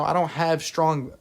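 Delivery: words cut off by a burp; talking through a burp. Real, not acted. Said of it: "I keep burping and stuff".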